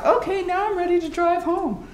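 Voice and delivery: a cheerful little reset; relieved and ready. Retold or imitated. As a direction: chirp it out as if all better. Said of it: "doesn't take very long".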